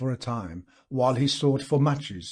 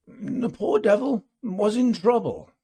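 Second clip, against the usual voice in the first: faint reproof; 8 semitones higher, 3 dB louder than usual. A narrator's reading. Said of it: "with the very faintest note of reproof".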